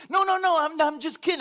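high, loud hurried retraction; panicked take-back. Retold or imitated. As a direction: backpedal fast, half laughing in alarm. Wife backpedals on the dare.

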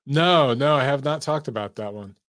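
normal talking voice

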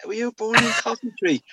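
high mocking impression of his mates; sing-song teasing "were you born in Coventry". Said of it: "my mates go".

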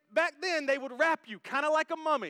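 usual speaking voice

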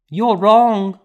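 put-on high, loud child's cry of denial; a little girl refusing the news; child protesting. A narrator's reading. Grieving child denies death.

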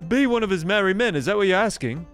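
high, brisk English character voice; Nottingham local. Acted. Local checking what the newcomer asks, high brisk character voice. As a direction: play an eager high-voiced townsman.

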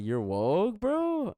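mock-astonished question; incredulous. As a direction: pitch leaps up sharply on bro. Retelling surprise at coworker's views.

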